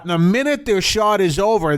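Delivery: normal talking voice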